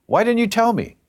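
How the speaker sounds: high-pitched retold aunt's reproach; indignant demand. Godmother confronting Tommy over her cancer, indignant.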